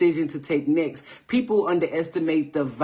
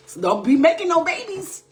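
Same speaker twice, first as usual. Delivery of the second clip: teasing warning; 12 semitones higher, 4 dB louder than usual. Real, not acted. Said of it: "I'm just kidding".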